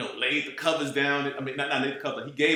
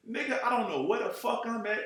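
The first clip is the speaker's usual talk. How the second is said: pissed-off complaint; 9 semitones higher than usual. Retold or imitated. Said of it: "But I was pissed".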